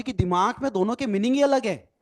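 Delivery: normal talking voice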